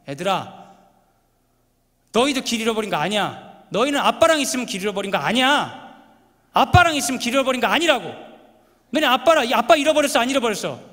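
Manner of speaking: irritated dad. Retold or imitated. A fed-up dad scolding his kids in the car, loud. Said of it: "너무 화가 나고 짜증이 나서 얘기했어요".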